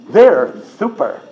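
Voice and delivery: slow, sweet "super" lisped as "thuper". Shown as a demonstration. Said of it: "Do it sweetly and with a lisp".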